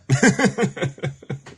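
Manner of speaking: laughing at herself, high; a light giggle up high. Real, not acted. Laughs at own impression.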